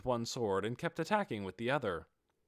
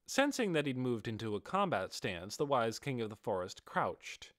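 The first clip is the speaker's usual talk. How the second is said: usual speaking voice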